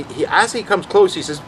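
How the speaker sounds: ordinary delivery, nothing marked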